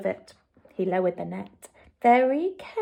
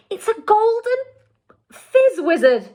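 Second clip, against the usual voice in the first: proud cry; 12 semitones higher, 6 dB louder than usual. A narrator's reading. BFG proud of rare catch.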